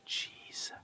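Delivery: sighing whisper. Real, not acted. Overwhelmed by the gold find.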